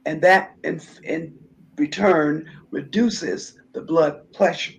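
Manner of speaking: normal talking voice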